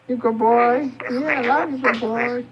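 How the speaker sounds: high cooing praise for the bird. Real, not acted. Baby talk to the parrot.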